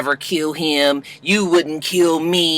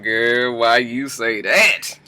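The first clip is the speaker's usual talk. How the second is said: sassy comeback; 6 semitones lower, 3 dB quieter, much slower than usual. Retold or imitated. Host answers the wife herself.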